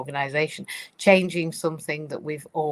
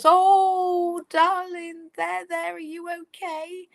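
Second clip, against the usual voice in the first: much higher, cooing, worried mum voice; fussing mum; 5 dB louder, slower than usual. Retold or imitated. Mum rushing to a fallen child, high and fussing. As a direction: coo over the child like an anxious mother. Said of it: "If mum comes along and goes".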